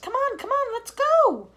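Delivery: high, loud, impatient calls; eager urging. Acted. Creatures urging everyone upstairs. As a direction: hurry everyone along.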